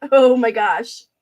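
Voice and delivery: loud gasp of surprise at the prices; surprised. Real, not acted. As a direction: blurt it out, genuinely amazed. Reacting to low prices.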